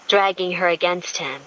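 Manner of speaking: usual speaking voice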